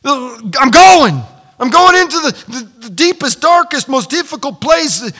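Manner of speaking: loud, high, emphatic preaching; fired-up resolve. Acted. Believer declaring himself invincible with God, fired-up and loud. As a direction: cry it out as if charging in.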